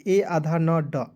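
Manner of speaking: ordinary talk, nothing marked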